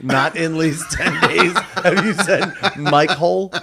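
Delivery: ordinary talk, nothing marked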